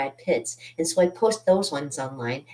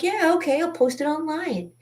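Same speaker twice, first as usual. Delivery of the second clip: high, bright-eyed yes; mock gushing enthusiasm; eager agreement; 12 semitones higher than usual. Eagerly agreeing to post painting.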